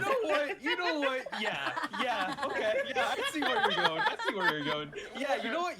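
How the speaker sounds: high laughing agreement. Real, not acted. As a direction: laugh through it.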